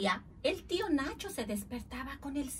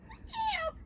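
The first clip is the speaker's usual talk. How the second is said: very high imitated squeal; animal screech. Animal reacts to the smoky house, high screech. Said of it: "El lobo chilló".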